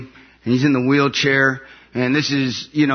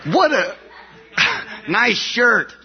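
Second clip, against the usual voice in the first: mock jealous shout; 10 semitones higher, slower than usual. Joking about the gifts his brother got, a mock jealous shout. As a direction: yell it out in fake envy.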